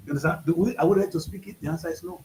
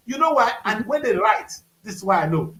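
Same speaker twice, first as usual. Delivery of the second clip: ordinary talk, nothing marked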